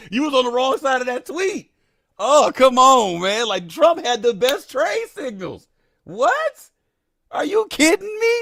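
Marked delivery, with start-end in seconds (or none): 2.2-5.7 mock disbelief. Real, not acted. Mock disbelief at chat comment. 6.0-8.3 high, loud disbelieving what?; incredulous. Real, not acted. Streamer reacting to a chat comment, high loud disbelief.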